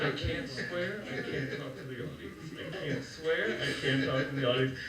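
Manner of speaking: very soft, low nervous muttering; acted hushed panic to yourself; frozen self-talk. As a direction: mutter it under your breath, stuck on repeat. Frozen on stage, talking to himself.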